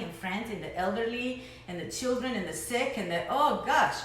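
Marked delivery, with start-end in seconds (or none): none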